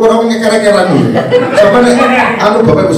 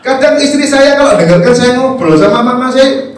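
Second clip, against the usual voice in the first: usual speaking voice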